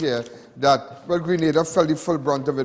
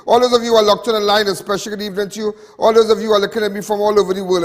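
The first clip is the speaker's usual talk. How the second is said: big, fast, loud greeting, pitched up high; loud show-opening announcer; 5 semitones higher, 8 dB louder, faster than usual. Real, not acted. Host welcoming viewers back to Crime Watch, loud fast announcer voice.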